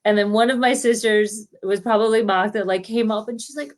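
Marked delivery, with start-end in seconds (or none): none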